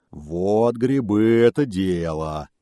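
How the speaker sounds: slow, loud pleased exclamation; delighted bear. A narrator's reading. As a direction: savour the good news. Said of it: "обрадовался медведь". (медведь радостно, нараспев)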